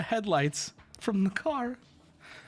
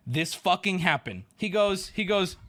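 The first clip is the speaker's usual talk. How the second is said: usual speaking voice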